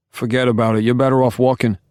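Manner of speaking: high, loud New York Italian bystander cutting in; brash New York Italian, butting into the talk; New York Italian accent. Acted. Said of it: "thick New York Italian accent".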